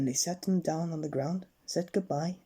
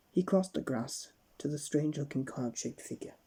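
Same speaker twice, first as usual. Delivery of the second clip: plain reading voice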